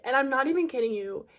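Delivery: usual speaking voice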